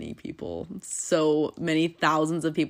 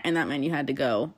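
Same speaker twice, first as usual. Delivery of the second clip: ordinary delivery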